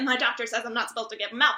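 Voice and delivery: ordinary talk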